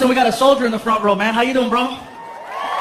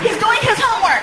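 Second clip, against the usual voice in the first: very high woman's voice, worked up; exasperated girlfriend; 12 semitones higher than usual. Acted. Girlfriend reports on son by phone, high exasperated voice. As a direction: complain in a high mock-female voice.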